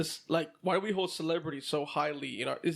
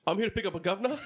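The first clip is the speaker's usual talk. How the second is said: "governor" said British as guv-nah, the r dropped; amused mock-British "governor", ending on a rise; British accent on "governor"; 2 semitones higher, much faster than usual. Customer asking at the parts counter, "governor" in a British accent. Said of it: "did you have to say it in a British accent?".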